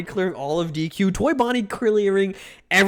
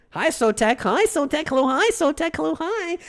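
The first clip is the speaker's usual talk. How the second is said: high greeting; 10 semitones higher than usual. Real, not acted. Greeting a chatter, sing-song.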